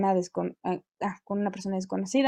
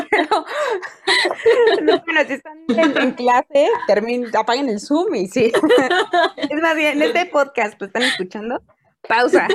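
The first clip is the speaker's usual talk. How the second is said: laughing; 5 semitones higher, 5 dB louder than usual. Real, not acted. Laughing after spitting water.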